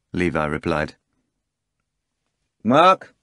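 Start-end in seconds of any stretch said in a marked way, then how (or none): 2.6-3.2 loud, high call across the terrace; shout. A narrator's reading. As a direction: bark the centurion's name. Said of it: "shouted the procurator".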